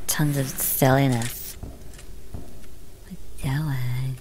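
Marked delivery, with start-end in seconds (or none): 1.6-4.2 slow whispered puzzlement. Real, not acted. Puzzled by the game.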